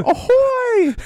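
very high, loud mock Irish holler; cartoonish high Irish shout; Conor McGregor. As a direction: bellow a terrible Irish impression up high. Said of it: "That's Conor McGregor".

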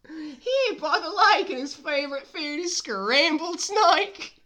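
very high, loud cartoon voice; loud squeaky storybook creature; excited animal character. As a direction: squeal it at the top of the voice.

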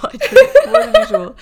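laughing through the words; high, loud laughing protest. Real, not acted. Laughing while defending herself.

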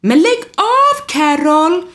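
very high, loud, slow outburst; roaring. Acted. Rodolfo roaring at Carol for teasing him, loud and high. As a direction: yell it, embarrassed and red-faced. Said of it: "vrålar Rodolfo".

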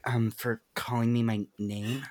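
softly imitated coy girl; hesitant, quiet quirky-girl voice; quirky girl moment. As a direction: trail off shyly, lightly mocking. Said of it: "quirky girl moment".

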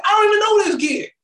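high, loud protest; mock outraged shout. Host playing the shocked driver, high loud shout. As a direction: yell in disbelief. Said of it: "I'm like".

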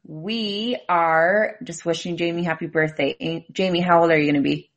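ordinary delivery

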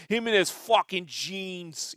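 gruff New Yorker, high and drawn out; Pat Duffy. Acted. Mimicking gruff shop owner's complaint, loud gruff griping. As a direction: stretch it out loud, a grumbling New York guy. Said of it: "He was a gruff dude".

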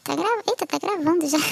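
usual speaking voice

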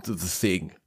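lightly put-on Austrian-Russian accent; Arnold Schwarzenegger. Dwarf loses his thread mid-boast, light Austrian accent. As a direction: let the accented bluster fizzle out, a hint of Austrian. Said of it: "I kinda just did an Arnold Schwarzenegger".

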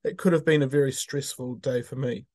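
normal talking voice

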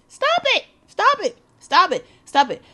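high, loud, repeated scolding; punchy mock telling-off; exasperated command. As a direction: start squealing high, step down with each repeat.

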